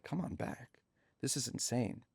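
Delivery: very soft, disbelieving echo; stunned aside. Retold or imitated. Comedian invited back to meet his idol, hushed disbelief. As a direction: say it under your breath, amazed.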